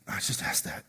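breathy whisper under the breath; whispered aside. Retold or imitated. Awkward small talk retold.

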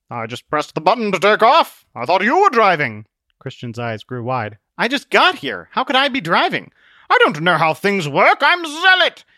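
high, loud hero voice with blustering confidence; blustering Zealot. Acted. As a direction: exclaim it loud and high like a brash adventurer.

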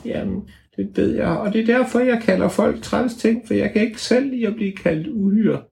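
put-on very high little girl elf voice; high squeaky elf voice; tiny elf voice. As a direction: speak as a tiny fairy girl.

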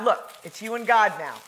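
ordinary talk, nothing marked

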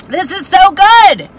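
high, loud burst of delight; passionate exclamation. Real, not acted. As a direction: gush it out with delight. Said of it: "I get really passionate, y'all".

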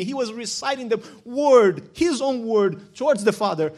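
loud, swelling preacher's voice building on each phrase; full-force preaching. Acted. Preaching climax on the cross.

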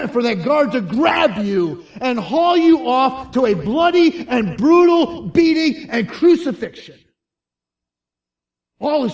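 building to loud, high intensity; full-force preaching. Real, not acted. Preacher picturing guards coming to the cell, building to full force.

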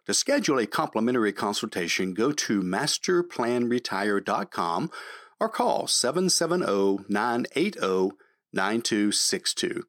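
polished announcer sign-off; radio outro read. Acted. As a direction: read it like a radio closing promo.

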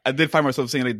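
normal talking voice

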